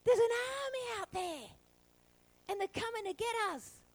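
high, breathless alarm; panicked servant. Retold or imitated. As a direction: blurt out the danger. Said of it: "runs flying back in".

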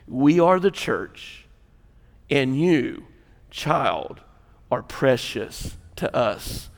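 slow, hushed and heartfelt; low, gentle pastoral assurance; tender reassurance. Real, not acted. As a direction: let each phrase settle softly, with pauses. Tender message addressed to a child.

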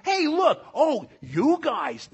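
high, loud, drawn-out mocking call; mock sarcasm. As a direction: tease them like a sneering older brother. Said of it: "speaks to these Corinthians in a level of sarcasm".

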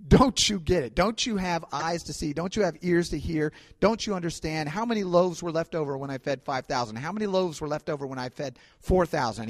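a preacher voicing an exasperated Jesus; exasperated rebuke. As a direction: let them have it, exasperated. Jesus scolding disciples, retold.

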